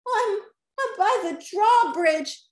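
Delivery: high, loud reply from afar; calling back. A narrator's reading. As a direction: shout your position across the castle. Peter calls back across castle.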